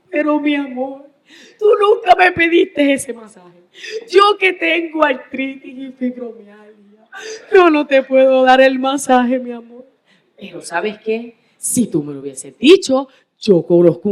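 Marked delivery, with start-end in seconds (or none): 0.1-9.8 acted wife's tearful plea with laughing sobs; tearful wife, half laughing. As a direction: playfully mimic a crying wife, laughing through it. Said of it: "la señora empieza a llorar y le dice". (esposa llorosa medio riendo)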